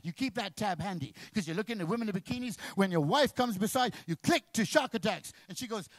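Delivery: ordinary talk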